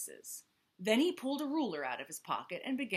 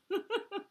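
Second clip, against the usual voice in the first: short laugh. Real, not acted. Reader laughs at the story.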